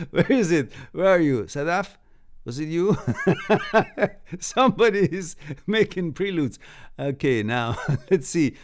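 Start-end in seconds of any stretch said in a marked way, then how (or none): 3.0-4.3 1 laugh. Real, not acted. Laughing at a chat line.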